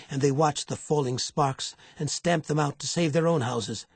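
ordinary delivery

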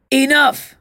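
high, very loud command; powerful shout. Acted. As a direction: stop the whole cell dead with one word. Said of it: "shouted a powerful voice".

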